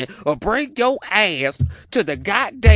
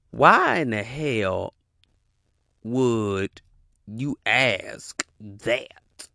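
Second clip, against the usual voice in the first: much lower, very slow disbelieving drag; slow disbelief; 8 semitones lower, much slower than usual. Real, not acted. Asking the woman on video why, slow disbelief. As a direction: stretch it out in disbelief.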